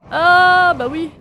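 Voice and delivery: loud, high and slow, drawn out; surprised shout. Real, not acted. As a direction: let it stretch in loud dismay. Falling in the game.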